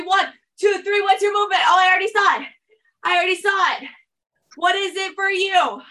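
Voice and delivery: high, lively coaching call; excited count-in. Real, not acted. Coach asking class to show their movement, excited.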